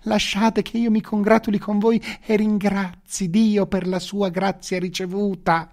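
high, drawn-out fawning courtier exclamation; exclaiming. A narrator's reading. Commander runs to greet the Sultan, exclaiming.

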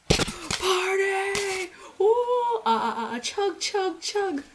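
very high, loud, whooping party yell; frat bro. Retold or imitated. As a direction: whoop and chant like a party animal. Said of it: "college is one of two things".

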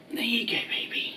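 soft whisper to the pet. Real, not acted. Owner whispering to the pet over its treat. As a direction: hush it as you hand over the treat.

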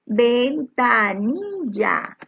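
"Ventanilla" slowly in separate syllables; very slow, syllabified "Ventanilla". Shown as a demonstration. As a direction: hold each syllable of "Ventanilla" for dictation. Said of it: "escribe separando en sílabas".

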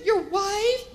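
high-pitched, hopeful awkward question; imitating an embarrassed clerk; uncomfortable saleslady. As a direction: ask it gingerly in a high voice. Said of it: "She was very uncomfortable".

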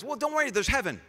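high, breezy brush-off; dismissive God. Pretend. Preacher mocking an uncaring God, high and breezy. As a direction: wave the pain away glibly. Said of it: "we can make it so that God has no compassion".

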